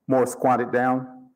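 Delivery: plain reading voice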